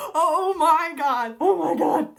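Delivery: loud, high, drawn-out astonishment; real thrilled disbelief; amazed exclamation. Real, not acted. Amazed by the magic beans.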